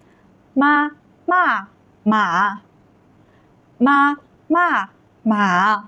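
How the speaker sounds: each syllable long and separate; drawn-out Mandarin model reading; very slow reading. Shown as a demonstration. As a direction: stretch out every tone for learners. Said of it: "I will read it very slow".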